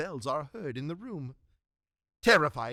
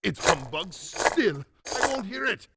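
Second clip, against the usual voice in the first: louder, agitated protest; alarmed denial; 3 semitones higher, 4 dB louder than usual. Acted. Scrooge alone hearing heavy footsteps, alarmed denial. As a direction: refuse to believe it, rattled. Scrooge denying the ghostly noises.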